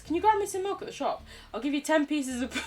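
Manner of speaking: high, loud, fast neighbour asking a favour; pushy neighbour. Pretend. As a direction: rattle off the request like a cheeky neighbour. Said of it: "imagine if Real Neighbours did this".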